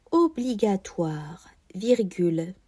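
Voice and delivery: usual speaking voice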